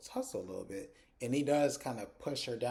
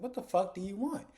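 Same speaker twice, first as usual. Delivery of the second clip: angry; 8 semitones higher than usual. Retold or imitated. Voicing angry ex on phone.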